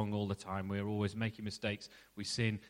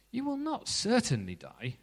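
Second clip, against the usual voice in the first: a lightly voiced serpent, pitched much higher; snake's reassurance; 11 semitones higher than usual. Snake contradicting God's warning to the woman, lightly voiced coaxing serpent. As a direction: coax her, sweetly dismissing the danger.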